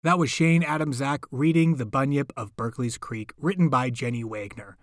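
ordinary delivery, nothing marked

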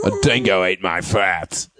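Australian impression. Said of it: "This is my impression of an Australian".

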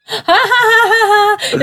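slow, deliberate ha ha ha; sarcastic fake laugh. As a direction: spell out a mocking laugh.

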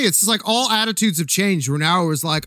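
normal talking voice

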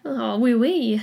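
playful mock-French accent; French accent for a joke. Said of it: "with a French accent now".

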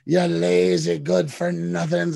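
hammed-up berating, every word stretched; mock screaming commander. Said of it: "He's just screaming at them".

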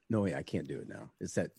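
usual speaking voice

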